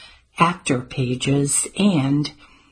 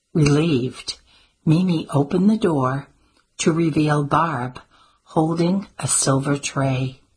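plain reading voice

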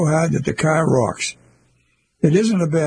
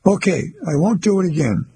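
ordinary delivery

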